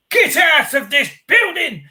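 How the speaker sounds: high, slow imitation of an angry official; angry woman. Imitating the furious woman at the CPS building, high and slow. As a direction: mimic her ordering them out. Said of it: "She goes, ... copped the arse bad".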